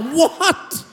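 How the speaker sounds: high-pitched incredulous yelp; shocked disbelief. Retold or imitated. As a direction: blurt it out in stunned disbelief. Shocked reaction to her answer.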